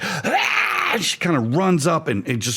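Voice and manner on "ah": burning woman's scream. Acted. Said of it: "she's just like".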